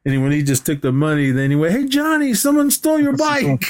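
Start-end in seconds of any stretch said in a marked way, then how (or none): 1.7-3.6 mock high excited voice. Acted movie quote, high voice.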